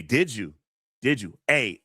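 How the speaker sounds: ordinary delivery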